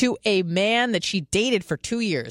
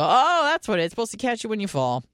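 dawning realization; 5 semitones higher, faster than usual. Retold or imitated. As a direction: act out the penny dropping. Acts out her sudden realization.

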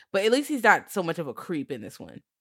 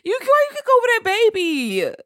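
much higher, louder outrage; high outraged voice; 9 dB louder than usual. Retold or imitated. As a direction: shriek it in shock. Outraged bystander imitation.

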